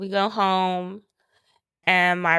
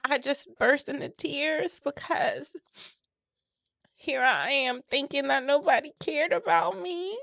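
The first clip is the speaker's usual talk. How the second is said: tearful; 6 semitones higher than usual. Real, not acted. Tearful retelling after crying.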